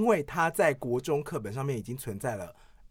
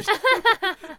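squealing laugh; bursting into high laughter; 5 dB louder than usual. Real, not acted. Parody cracks her up.

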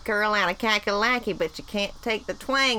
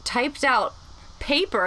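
normal talking voice

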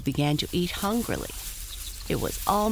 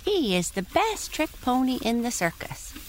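high proud pony voice; talking pony; 9 semitones higher than usual. Acted. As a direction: boast like an eager pony. Tiny Tim voice.

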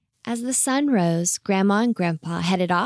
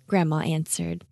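plain reading voice

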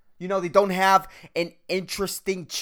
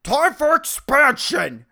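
higher, louder, slowed mock announcement; eager forehead voice; 8 semitones higher, 8 dB louder, slower than usual. Balding man voicing his forehead, excited and loud. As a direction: voice a forehead gearing up to grow. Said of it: "my forehead's like".

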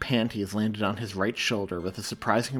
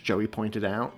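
usual speaking voice

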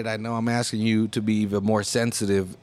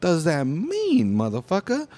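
slow, drawn-out what the fuck; 6 semitones higher, much slower than usual. Retold or imitated. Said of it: "it was like".

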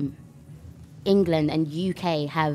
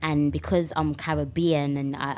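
ordinary talk

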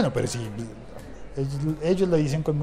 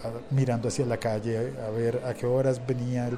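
usual speaking voice

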